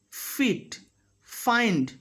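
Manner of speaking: FEET and FIND with long airy F; "fff-eet, fff-ind"; clear F. Shown as a demonstration. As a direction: hold the F friction before each vowel of FEET, FIND. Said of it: "I am biting my lower lip".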